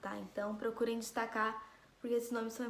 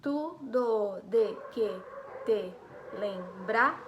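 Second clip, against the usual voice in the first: slow separate beats, "do" as one joined syllable, louder; poetic syllable count; 4 dB louder, much slower than usual. Shown as a demonstration. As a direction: count out "tu, do, de, que, te, lembrar", stopping on a stretched "brar". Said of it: "vou fazer essa aqui com vocês".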